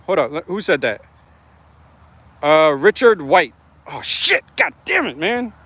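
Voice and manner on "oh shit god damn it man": high startled yelp of surprise. Real, not acted. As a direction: jump at the name, shocked. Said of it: "I just had, like, a P T S D moment".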